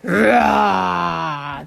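loud playful roar; mock lion roar. Hulu starting the chase, a playful roar. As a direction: growl out like a lion. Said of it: "的叫了一声".

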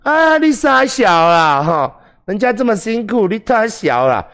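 put-on high, slow coy girlfriend voice; tender girlfriend voice. Shown as a demonstration. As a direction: coo it slowly and sweetly. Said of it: "这时候女孩子就会说".

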